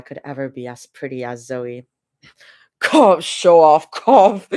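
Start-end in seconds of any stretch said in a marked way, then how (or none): 2.8-4.5 mock cough. Fake-cough tease at her partner.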